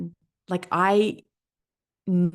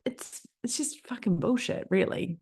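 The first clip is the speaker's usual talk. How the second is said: dismissive aside; 6 semitones higher, 5 dB quieter than usual. Retold or imitated. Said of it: "And I'm like".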